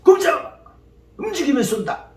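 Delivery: high-pitched, acted warning call; sentry's threat. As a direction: play a guard challenging intruders. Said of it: "이렇게 해야 되는데".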